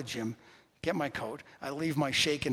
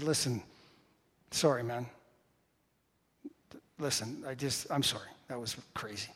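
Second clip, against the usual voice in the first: low, softened retold apology; subdued, apologetic, dropping away at the end; contrite apology; 4 semitones lower, 7 dB quieter than usual. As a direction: back down quietly and say sorry. Retells his apology to the man.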